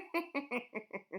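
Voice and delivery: high evil cackle; witch cackle. A narrator's reading. Witch laughs.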